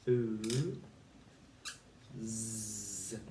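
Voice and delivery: ooh held out, then a buzzing z; sounding out phonics. Shown as a demonstration. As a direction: give each sound on its own. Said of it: "So we've got".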